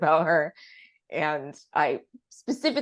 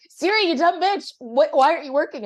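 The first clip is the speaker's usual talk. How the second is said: higher, louder frustrated yell; angry yell at Siri; 11 semitones higher, 5 dB louder than usual. Retold or imitated. Dan yelling at Siri lost on the highways, exasperated. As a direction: snap at the useless phone. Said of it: "so lovely man, was like".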